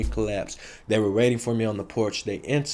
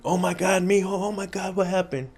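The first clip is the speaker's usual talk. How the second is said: put-on higher, alarmed older Mexican lady; worried aunt; 9 semitones higher than usual. Aunt running to her hurt nephew, high alarmed cry. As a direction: call out like a frightened aunt.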